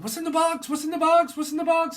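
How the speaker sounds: shrill, very high and loud repeated question; frantic demand. Pretend. A frantic voice demanding what is in the box, very high and loud. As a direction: yell it like a panicked movie hero.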